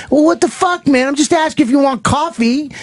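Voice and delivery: high-pitched acted-out indignation; mock exasperation. Pilot snaps back over an offer of coffee, exasperated.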